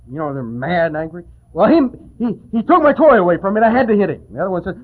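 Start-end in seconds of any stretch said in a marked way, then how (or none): 1.5-4.2 put-on higher, louder, fast childish whine; whiny little boy. Little brother explaining the fight to a parent, fast indignant child whine. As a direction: blame your brother like a wronged kid.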